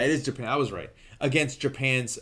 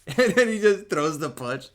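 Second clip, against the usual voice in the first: higher, louder, laughing delivery; 7 semitones higher, 7 dB louder than usual. Real, not acted. Reviewer amused by the punch after a humility speech, laughing. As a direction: burst out laughing as you tell it.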